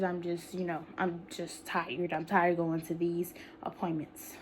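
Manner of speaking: unvoiced, worn-out whisper; tired whisper. Real, not acted. Telling viewers she is tired of appointments, whispered. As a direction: let the tiredness drop into a whisper. Weary complaint about doctors.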